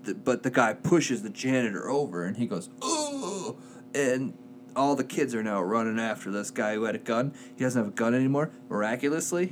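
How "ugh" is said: high, soft "ugh" of impact; imitated high groan of someone falling; mock grunt. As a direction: groan as if shoved over. Pushed-over janitor's cry, acted out.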